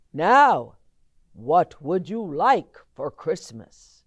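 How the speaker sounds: loud, jolly Santa question; Santa asking. Acted. Santa booming his question to the boy. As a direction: boom it out like Santa.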